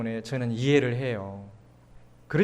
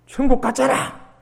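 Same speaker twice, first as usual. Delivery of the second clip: put-on scolding old pastor; 8 semitones higher, 4 dB louder than usual. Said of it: "그러시는 거예요".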